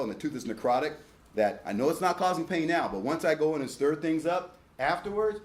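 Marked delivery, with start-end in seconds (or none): none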